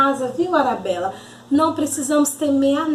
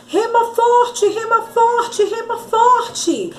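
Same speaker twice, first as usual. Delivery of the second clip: high, drawn-out urgent chant; desperate urging; 8 semitones higher, slower than usual. Acted. Pedro urging João on in the storm, desperate. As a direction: urge the rower on, pitched high. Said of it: "começou o desespero".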